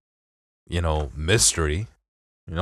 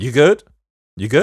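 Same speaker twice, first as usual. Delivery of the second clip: louder, higher Detroit You good pushing on good; Detroit greeting; 6 semitones higher, 6 dB louder than usual. Shown as a demonstration. Pressing his cohost, Detroit accent, louder and higher. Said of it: "I say like a Detroiter".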